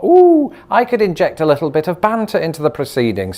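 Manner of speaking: high, bright ooh of mischief, then settling; mock excited thought. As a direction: squeal the ooh like a plotting idea. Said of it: "I thought".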